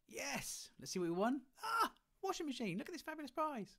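game show excitement. Pretend. Game show prize reveal.

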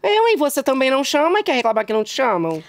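high-pitched scolding imitation of a whiner; mocking complaint. Host scolding guest who never invites her, mocking. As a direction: throw it back at her, high and quick.

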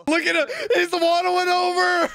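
high squeal breaking into laughter; amused squealing. Real, not acted. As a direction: squeal it, about to burst out laughing. Amused at waddling character.

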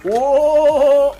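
long high cry of "oh"; loud drawn-out "oh". Real, not acted. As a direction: belt out a sustained, loud "oh". Game action, sudden cry.

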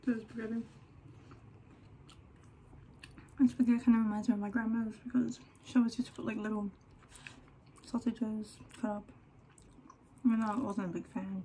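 eating between words; talking while chewing. Real, not acted. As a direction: mouth partly full of pasta. Seen 2: Bites spaghetti at -3, again at 8.3; talks while chewing.

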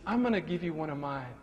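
drops into a whisper on the last words; gentle whisper. Retold or imitated. Cashier whispering to the sad old man as she offers a hug. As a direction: confide it softly like a kind secret.

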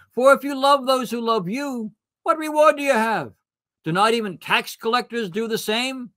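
high, ringing preached verses; fervent scripture reading. Acted. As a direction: proclaim the verses with rising fervour. Reads scripture with rising fervour.

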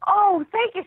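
high, loud gush of flattered thanks; delighted thanks. Retold or imitated. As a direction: squeal the thanks, tickled pink. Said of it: "I'm like".

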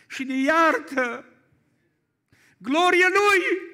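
full-force preaching. Acted. Pastor to the congregation praising God's mercy, full-force preaching.

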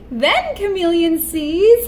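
usual speaking voice

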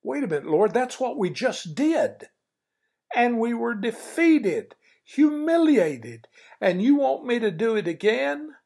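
high, rising disbelief; incredulous protest. Acted. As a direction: protest in shock to the Lord. Joshua protesting to the Lord.

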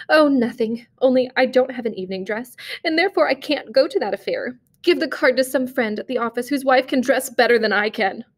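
usual speaking voice

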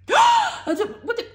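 very high, loud gasp of disbelief; shocked gasp. Real, not acted. Vlogger pulling the same card again, shocked gasp. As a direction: gasp at yet another duplicate.

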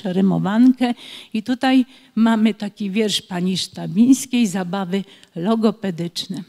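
ordinary delivery

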